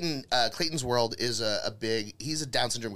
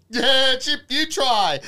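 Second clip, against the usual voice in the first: much higher and louder cheerful Clayton voice; excited Clayton; 12 semitones higher, 9 dB louder, much slower than usual. Retold or imitated. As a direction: push the chips on her, gleefully loud and high. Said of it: "And he goes".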